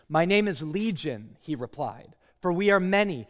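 loud anguished cry of the possessed man; loud desperate plea; demon's shout. Acted. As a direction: cry out loud at the top of your voice. The demon answers Jesus.

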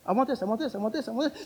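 put-on high, rapid repeated kid's plea; begging young child. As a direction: pester like a child wanting sweets. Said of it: "young kids asking for".

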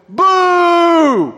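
a son's loud, high booing shout from the back seat; passionate boo. Retold or imitated. Son in the back seat booing his school, loud high shout. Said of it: "I'm talking, like, passionate boo".

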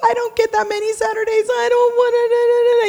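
high, whining grumble about the early Saturday; mock whiny reluctance. Preacher voicing the lazy Saturday thought, mock whiny. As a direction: moan like you'd rather stay in bed. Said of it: "Your first thought is".

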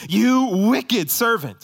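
high, loud and drawn-out condemnation; thundering rebuke. Acted. Preacher voicing Jesus to the unforgiving servant, thundering rebuke. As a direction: thunder it slowly from the pulpit. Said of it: "That's why Jesus says".

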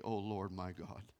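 low, soft, slow plea to God; quiet, slow calling on God; hushed prayer. Retold or imitated. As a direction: pray under your breath. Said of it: "He says".

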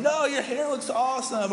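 high-pitched mock gushing; hammed-up insistent reassurance. Friend reassuring a compliment-seeker, gushing. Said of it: "they want you to say what".

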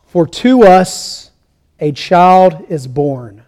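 scripture reading. Real, not acted. Pastor reading the word of the Lord to his congregation, solemn.

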